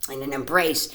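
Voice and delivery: plain reading voice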